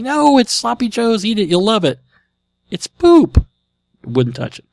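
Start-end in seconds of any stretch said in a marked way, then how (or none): 0.0-2.0 coaxing parent. Retold or imitated. 2.7-3.5 put-on very high, louder whiny kid; toddler voice. Toddler repeats his refusal.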